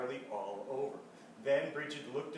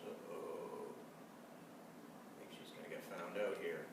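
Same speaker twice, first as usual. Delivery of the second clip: breathy whispered aside, then low; 9 semitones lower, 10 dB quieter than usual. Real, not acted. Reader whispering a warning to the children.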